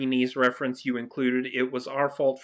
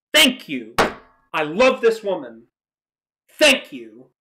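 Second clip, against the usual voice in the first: louder, emphatic thank you; 7 dB louder than usual. Real, not acted. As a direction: cheer her on. Cheering the actress's reply.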